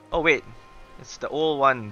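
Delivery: normal talking voice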